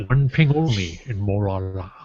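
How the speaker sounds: low Scottish submarine captain; a Scottish captain giving orders; Sean Connery accent. Pretend. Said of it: "with the Sean Connery accent".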